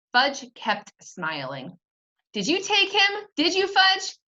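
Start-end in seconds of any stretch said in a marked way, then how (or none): 2.3-4.2 higher, louder, rising impatience; not so calmly. Acted. Peter accusing Fudge, losing his calm. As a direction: press the little brother, much higher. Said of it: "I asked not so calmly".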